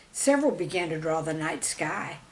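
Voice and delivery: ordinary delivery, nothing marked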